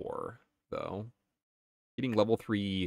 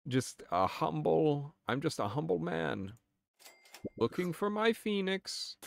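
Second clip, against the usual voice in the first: humble beggar voice; 8 semitones higher than usual. Pretend. Humble man role play.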